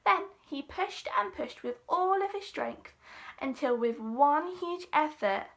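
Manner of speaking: plain reading voice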